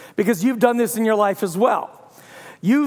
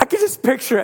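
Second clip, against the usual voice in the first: ordinary delivery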